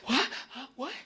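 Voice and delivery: a jacked-up guy who never knows what's going on; Mark Wahlberg. Retold or imitated. Boston movie star lost on set, high confused yelps. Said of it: "He's always confused".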